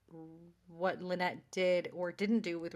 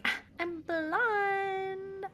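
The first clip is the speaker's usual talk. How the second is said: much higher, slow, ditzy drawl; mock airy girl; 12 semitones higher, 3 dB louder, much slower than usual. Host mocking Julie, slow high airy voice. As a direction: sing it out like a clueless teen.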